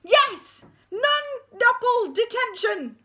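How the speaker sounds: high, loud drawn-out bellow; deputy head screaming the punishment; furious shout. Acted. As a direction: roar detention at the school.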